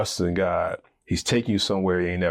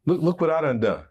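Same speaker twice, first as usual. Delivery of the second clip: boastful; 4 semitones higher than usual. Retold or imitated. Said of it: "start to think".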